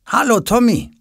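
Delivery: high, sing-out hello; cheerful greeting. A narrator's reading. Father comes into the room, greeting cheerfully. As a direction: greet your child in high good humour. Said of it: "Der Vater kommt gut gelaunt ins Zimmer".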